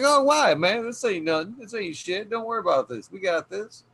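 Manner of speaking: high-pitched retold brush-off of someone's nerves; imitated breezy reassurance. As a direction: wave off the worry, pitched well up. Guest waves off host's nerves.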